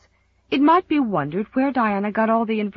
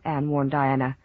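ordinary delivery

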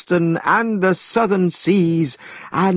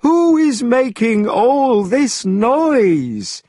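high, loud, slow demand; annoyed demand; 10 semitones higher, 4 dB louder, much slower than usual. A narrator's reading. The immortal scolding the noisy students, high and loud. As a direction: call out sharply over the noise.

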